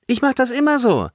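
quick, high, impudent brag; saucy, unapologetic quip; cheeky. A narrator's reading. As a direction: toss it off with a grin. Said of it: "grinste frech".

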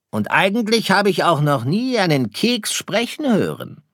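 storybook fox, teasing; sly fox. Acted. Fox teases the star. (neckender Fuchs, hohe Stimme)

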